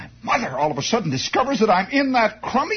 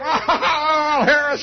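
giggling out the words, breaking into laughter; laughing; 6 semitones higher, much slower than usual. Retold or imitated. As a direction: laugh helplessly through the name. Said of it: "I'm laughing".

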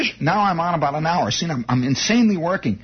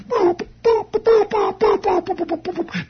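high-pitched boop boop beeps; imitating Morse code; 11 semitones higher than usual. Ham radio operator mimics incoming code, high mock beeps. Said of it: "here we go".

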